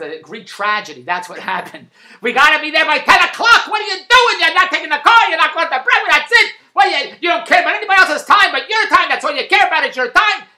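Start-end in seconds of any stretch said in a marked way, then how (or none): 2.2-10.5 high, loud, angry tirade at full force; angry yelling. Retold or imitated. Wife scolding husband about being late, loud angry yelling. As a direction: yell like she's furious you're late. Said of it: "And just hollered at me".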